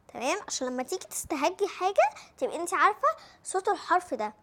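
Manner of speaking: ordinary talk, nothing marked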